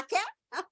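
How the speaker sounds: "kake" split into kah-keh; wrong pronunciation. Shown as a demonstration. Teacher offers a misreading of cake, "kake" in two syllables. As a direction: sound the E ending of "kake". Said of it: "No, you won't do that".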